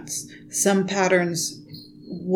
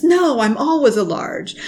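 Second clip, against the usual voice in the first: higher, louder mock protest; insistent customer; 7 semitones higher, 5 dB louder than usual. Knitter retelling customers who ignore the measurements, mock insistent. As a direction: imitate someone stubbornly insisting, pitched up. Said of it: "I've seen many people say".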